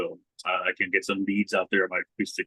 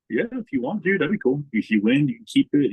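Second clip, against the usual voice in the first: upbeat offer; 11 semitones higher, 4 dB louder than usual. Retold or imitated. Offering a kid a card bet.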